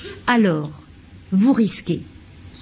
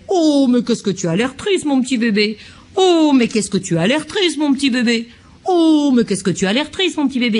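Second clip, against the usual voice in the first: exaggerated caricature; 4 semitones higher than usual. Shown as a demonstration. Lecturer caricaturing a parent who keeps cooing at the baby, hammed-up. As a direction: ham up the sugary parent over and over. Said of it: "Excusez-moi de caricaturer".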